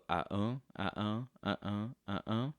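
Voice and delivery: "uh-uh" as a bare vowel beat, second half stressed; wordless iambic "uh-uh" pulse; syllables without consonants. Shown as a demonstration. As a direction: bounce through "uh-uh" with no consonants. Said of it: "take out all of the consonants".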